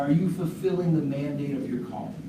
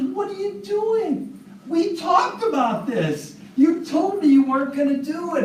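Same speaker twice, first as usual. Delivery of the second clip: shocked betrayed farmer; 13 semitones higher, 4 dB louder than usual. Acted. Bitten farmer confronts snake.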